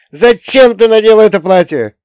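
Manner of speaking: put-on high, loud childish cry; piercing child's outburst; boy's shout. A narrator's reading. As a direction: yell it like a distraught little boy. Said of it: "крикнул Лёня".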